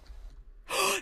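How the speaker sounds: sharp breathless gasp of shock. Real, not acted. Gasping at the unlocked door in shock. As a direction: gasp at what you just found.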